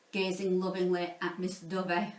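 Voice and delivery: ordinary delivery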